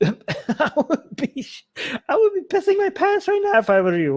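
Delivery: high, laughing through the words; half-laughing in disbelief. Real, not acted. Vlogger telling viewers what they'd do, laughing.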